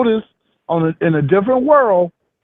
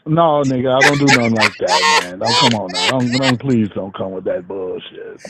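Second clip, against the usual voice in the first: a hint of street talk, dropped lower; 8 semitones lower than usual. Shown as a demonstration. Showing his friend his street talk, lower and relaxed. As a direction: slide into the street voice, low and loose. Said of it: "when I'm talking that street shit".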